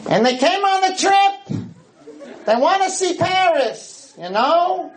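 put-on very high old-grandmother voice, laughing. Rabbi voicing the two old grandmothers on the Paris trip, very high. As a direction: squeak it out like an old granny. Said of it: "Altababas mean old grandmothers".

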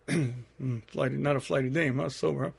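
soft, low sarcastic aside; muttering to himself. Retold or imitated. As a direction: grumble it under your breath, wry. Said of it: "Says to himself".

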